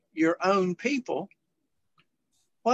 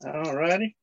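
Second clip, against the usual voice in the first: ordinary talk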